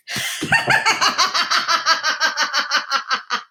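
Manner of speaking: long high peal tumbling downward; big forced guffaw that keeps rolling; put-on contagious laughter. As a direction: laugh as if passing it on. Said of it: "with a giggle or catch with a big guffaw".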